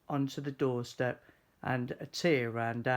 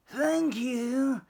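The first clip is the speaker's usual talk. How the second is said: high squeaky fox voice; a tiny fox saying thanks; high small animal voice; grateful little fox; 11 semitones higher, 3 dB louder than usual. A narrator's reading. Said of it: "said the fox".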